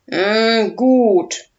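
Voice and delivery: grumbling dragon. Acted. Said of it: "brummt Udo".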